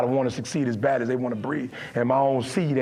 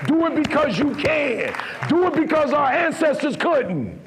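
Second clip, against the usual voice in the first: building preaching; 7 semitones higher than usual. Acted. Motivational speaker preaching to young men, building up. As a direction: build up to a sermon peak.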